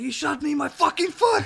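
high-pitched yell of a man just shot; outraged shout. Acted. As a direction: imitate a wounded victim's indignant outburst. Acted quote of shot man.

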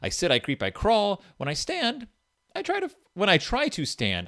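high, sing-song storybook read; reading to a toddler. Acted. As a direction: read it like to a baby. Reads baby book aloud.

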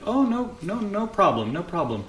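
high, breezy reassurance; high at first, settling as it repeats; polite brush-off. Acted. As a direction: wave the thanks away in a high voice. Visitor brushes off thanks.

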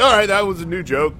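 a jittery new comic announcing the joke; nervous comic. Retold or imitated. Comic at an open mic, nervously loud. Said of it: "You're like".

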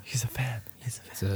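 awed little whisper; gasp, then whisper it in awe. Real, not acted. Excited gasp at guest.

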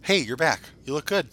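ordinary delivery

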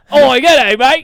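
loud, high, hammed-up Australian; mock Australian accent. Joking about the parlor staff, mock Australian accent. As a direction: put on a big, shouted Aussie accent. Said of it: "like, an Australian accent".

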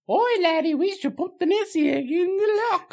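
high, put-on Scottish lassie voice; mock Scottish accent. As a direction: imitate the girl's Scottish brogue. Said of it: "the girl's like".

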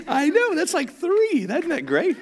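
amused at his own pun; laughing. Real, not acted.

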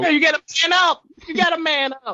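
high-pitched, loud heckle; mock urging shout. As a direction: holler it like a hammed-up pep talk. Interjects a mock urging to Chase.

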